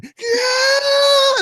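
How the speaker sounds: very high sustained motor scream; imitated engine whine. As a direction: whine like a plane humming at full speed. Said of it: "It is humming".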